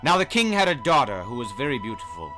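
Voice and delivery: ordinary delivery, nothing marked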